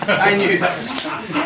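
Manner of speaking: high, laughing through words. Real, not acted. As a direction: say it while still laughing. Laughing at the joke.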